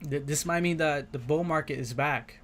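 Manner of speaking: ordinary talk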